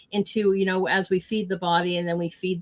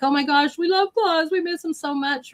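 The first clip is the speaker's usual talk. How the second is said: put-on high, gushing kids' voice, much higher; excited kids; 10 semitones higher than usual. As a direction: gush like the excited children. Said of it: "They're like".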